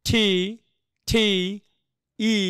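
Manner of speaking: aspirated "টি", held long; pronunciation demo. Shown as a demonstration. Teacher models the letter sound for students, pronunciation demo. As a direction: draw out "টি" with a puff of air. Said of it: "এটার উচ্চারণ কি হবে".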